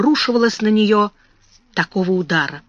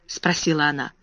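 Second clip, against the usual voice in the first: plain reading voice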